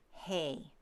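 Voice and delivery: "hey" for he, with an A sound; Middle English vowel. Shown as a demonstration. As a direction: shift the E of he to an A, saying "hey". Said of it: "The E shifts to an A sound".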